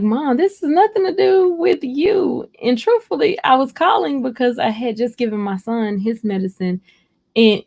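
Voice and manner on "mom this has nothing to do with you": high, earnest plea to her mother; much higher, urgently reassuring mom; pleading with mom. Retold or imitated. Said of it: "And I'm like".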